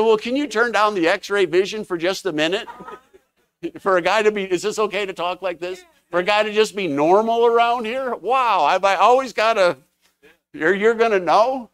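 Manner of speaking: loud, high, laughing disbelief; laughing protest. Retold or imitated. Telling the pastor off in fun, laughing loud and high.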